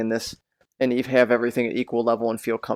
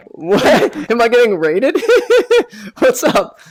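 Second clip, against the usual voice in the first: laughing surprise; 11 semitones higher, 8 dB louder, slower than usual. Real, not acted. Surprised by a sudden raid.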